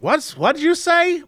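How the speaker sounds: mock American shop clerk, not fully committed; American accent. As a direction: play a puzzled American server asking again. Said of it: "with that very American accent".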